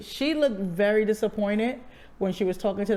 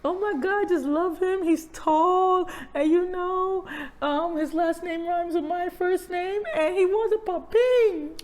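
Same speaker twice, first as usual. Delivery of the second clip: playful mock high voice; 8 semitones higher than usual. Mock skit, playing a parent.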